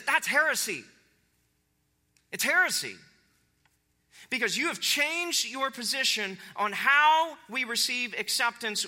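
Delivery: high, emphatic denunciation; fired up. Acted. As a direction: accuse them with pauses for weight. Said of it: "he is fired up".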